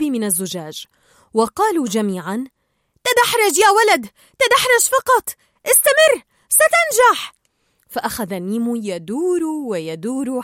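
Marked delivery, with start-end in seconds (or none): 3.0-7.3 high, loud cheering on; shouted encouragement. A narrator's reading. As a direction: urge the kid on, climbing higher with each call. Tank fish all urge Nemo on.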